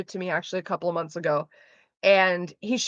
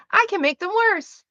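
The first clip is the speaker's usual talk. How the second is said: pitched-up put-on catchphrase; high mock voice; 10 semitones higher than usual. Offering a second catchphrase before rejecting it, high-pitched. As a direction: toss it out in a high, playful voice.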